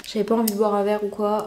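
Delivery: normal talking voice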